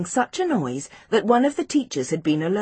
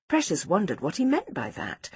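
plain reading voice

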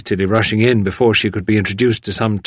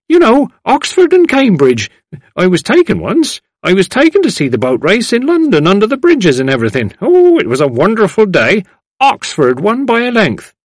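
put-on high, delighted old lady; loud, high, fond recollection of an outing; old lady reminiscing; 11 semitones higher, 6 dB louder than usual. A narrator's reading. Old lady recalls boat race.

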